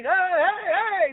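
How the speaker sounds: high, bouncy hey hey hey to strangers; overeager greeting. Retold or imitated. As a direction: chirp it like you've been locked away for years. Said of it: "I'm just going".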